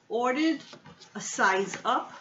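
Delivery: usual speaking voice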